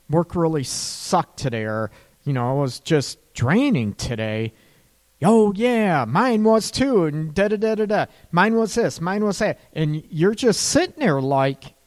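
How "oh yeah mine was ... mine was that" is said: higher, eager self-centred chatter; self-absorbed partner. Retold or imitated. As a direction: bulldoze past them with your own day.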